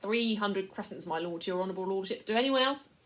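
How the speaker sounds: butter up the buyer; wheedling Pug. A narrator's reading. Slaver selling the boy to the lord, fawning.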